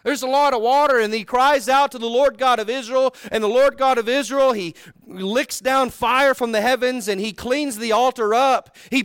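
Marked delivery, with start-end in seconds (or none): none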